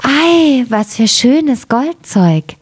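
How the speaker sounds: slow, lifted admiration; admiring wonder. A narrator's reading. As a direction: marvel slowly at a treasure. Admiring the gold wares.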